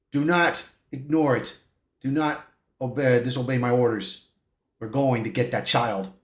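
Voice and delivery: low, quiet overlord forcing obedience; forceful villain's command. Retold or imitated. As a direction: impose your will low and softly. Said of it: "with his will, forcing her".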